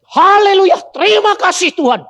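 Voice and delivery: high, full-volume cry of praise; loud shout. Retold or imitated. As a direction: shout your last praise with all you have. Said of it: "Teriak keras". (teriakan syukur sekuat tenaga)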